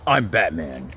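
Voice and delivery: deep Batman take. Shown as a demonstration. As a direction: drop the pitch way down for Batman. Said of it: "Why that sound so weird?".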